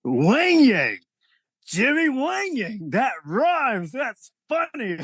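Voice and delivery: high, drawn-out, delighted boss impression; Vince McMahon. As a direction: savour the name slowly, thrilled with your joke. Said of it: "And said".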